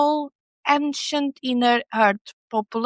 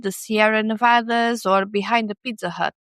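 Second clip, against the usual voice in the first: normal talking voice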